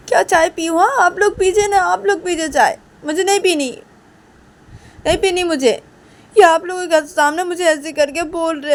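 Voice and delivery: high, loud wailing complaint; acted offended tirade, sustained and loud; angry aggrieved outburst. Uma's angry refusal and accusation.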